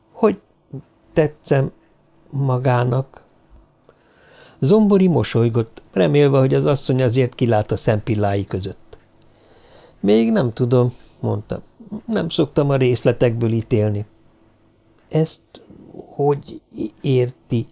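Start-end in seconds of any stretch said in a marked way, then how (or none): 0.1-3.2 halting, a pause after each word. A narrator's reading. Woman asks the man's opinion of her, word by word. 15.1-17.7 halting question, pauses between words. A narrator's reading. Woman questions the man's judgement, word by word.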